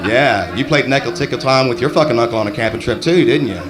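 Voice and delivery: usual speaking voice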